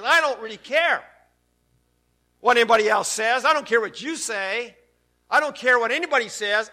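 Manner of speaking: loud, high, fervent preacher voice; defiant pulpit proclamation; preaching at full force. Acted. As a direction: drive it home like a revival sermon.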